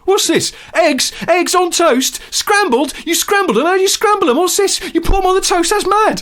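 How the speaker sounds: very high, loud mock bafflement; hammed-up hyperactive presenter; astonished shouting. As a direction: yell each question as a fresh shock. Mimicking presenter baffled by breakfast.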